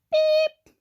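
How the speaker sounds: high, loud little truck beep; truck horn beep. A narrator's reading. Blue greets the toad, high beep. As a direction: beep like a small cheerful horn. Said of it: "said Blue".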